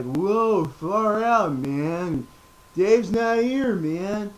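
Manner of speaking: high, slow stoner drawl; lightly voiced spaced-out hippie; Tommy Chong. Quoting Floyd as Tommy Chong, a lightly voiced stoner drawl. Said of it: "he kinda sounds like Tommy Chong".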